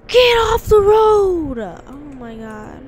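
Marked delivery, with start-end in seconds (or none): 0.1-1.6 higher, louder, slow yell; furious shout at a driver blocking her; angry shout. Real, not acted. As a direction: scream at the other car. Yelling at driver.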